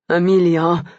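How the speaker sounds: a mild groan of the name. Acted. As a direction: groan her name, understated. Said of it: "he groaned".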